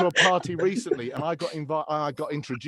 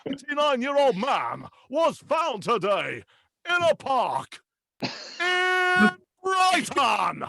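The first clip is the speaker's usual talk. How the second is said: high, slow booming headline; announcer as newsreader; 10 semitones higher, 3 dB louder, slower than usual. Acted. TV announcer reading grim radio news, absurdly drawn-out boom. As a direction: hold suspense, then belt out the place name. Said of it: "he started off as a newsreader".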